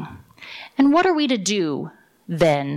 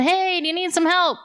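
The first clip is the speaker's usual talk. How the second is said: higher, different voice of a rescuer calling down; helicopter rescuer calling; 10 semitones higher than usual. Acted. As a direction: call out as the helicopter crew, pitched up. Helicopter crew calls to man.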